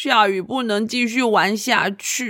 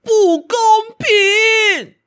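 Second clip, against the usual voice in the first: slow, loud protesting yell; shout; 11 semitones higher, 4 dB louder, much slower than usual. Acted. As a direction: yell it out as unfair. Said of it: "突然有一个人大喊".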